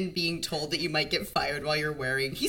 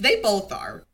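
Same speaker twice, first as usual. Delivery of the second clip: normal talking voice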